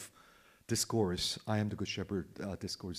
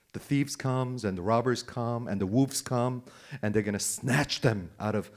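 ordinary talk

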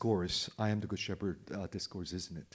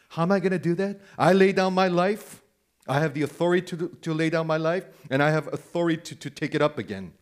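raised, louder voice as Jesus; emphatic, elevated preaching quote; more plain-spoken; 4 semitones higher, 6 dB louder, faster than usual. Retold or imitated. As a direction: declare it with insistent force. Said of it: "he's gonna speak more plainly".

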